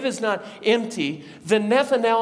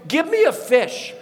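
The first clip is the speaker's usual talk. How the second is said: playful insistence; 8 semitones higher, 4 dB louder than usual. Acted. Jesus tries proving himself, playfully.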